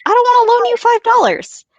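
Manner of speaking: rising to a loud high outburst; indignant refusal. Retold or imitated. Boromir refusing the stranger, building to a loud high outburst.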